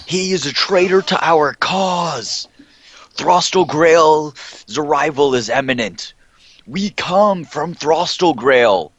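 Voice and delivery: high, slow, booming proclamation; zealous cult herald. Acted. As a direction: preach it to the clans like a zealot. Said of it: "I'll just say".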